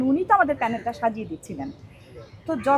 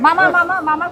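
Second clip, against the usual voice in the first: calling out; 4 semitones higher, 6 dB louder than usual. Real, not acted. Calling out to someone nearby.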